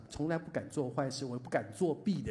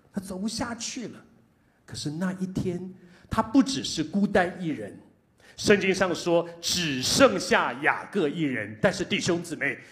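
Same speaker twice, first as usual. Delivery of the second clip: louder, higher building sermon; preaching at full force; 5 semitones higher, 10 dB louder than usual. Acted. Pastor to brothers and sisters, rising loud preaching. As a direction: rise toward the climax.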